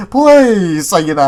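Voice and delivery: slow, loud drawn-out please; mock desperate wish; pleading. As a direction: beg with an exaggerated stretched plea. Re-enacts begging ship to appear.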